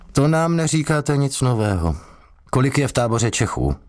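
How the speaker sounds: lightly voiced suspicious interrogator. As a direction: press him like a suspicious cop. Interrogator dismisses his answer.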